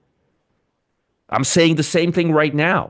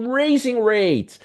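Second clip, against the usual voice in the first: normal talking voice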